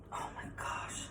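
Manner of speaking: breathless whispered gasp of delight; hushed, almost voiceless; awed whisper. Real, not acted. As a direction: whisper it as the smell hits you. Smelling the cooked artichoke.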